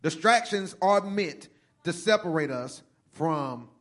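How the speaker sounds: loud, rising sermon delivery; full-force preaching. Acted. Pastor warning the church about distractions, preaching loudly. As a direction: preach it hard to the congregation.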